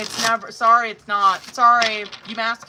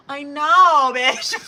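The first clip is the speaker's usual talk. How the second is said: loud, higher, emphatic outburst breaking into laughter; exasperated agreement; 6 semitones higher, 10 dB louder, much slower than usual. Real, not acted. Reader agreeing about his indecision, loud and exasperated. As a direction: agree at full volume, half laughing.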